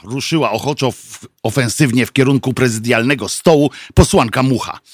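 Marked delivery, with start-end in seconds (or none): none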